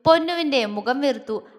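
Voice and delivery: plain reading voice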